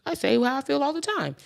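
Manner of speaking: high, soft mocking voice; sing-song parody of herself; mock claim. Podcaster mocking a boast of openness, high and soft. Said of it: "I'm not gonna sit here and be like".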